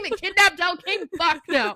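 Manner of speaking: loud, laughing disbelief at the request; laughing scoff. Retold or imitated. Retelling a character refusing, loud laughing scoff.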